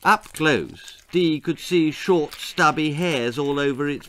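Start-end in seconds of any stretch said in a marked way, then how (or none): none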